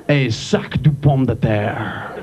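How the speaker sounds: mock French pronunciation; French phrase. Shown as a demonstration. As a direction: say it low and slow in French. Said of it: "with a potato sack".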